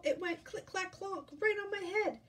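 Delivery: high, alarmed little chick; excited storybook chick; Chicken Little, panting. Acted. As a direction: blurt it out in a high voice. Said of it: "panted Chicken Little".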